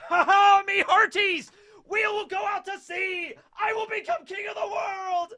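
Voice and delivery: high-pitched, half-crazed megalomaniac shouting with a mad laugh; megalomaniacal pirate Rox. Acted. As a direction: bellow it like an unhinged pirate captain. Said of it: "one step shy of just absolutely insane".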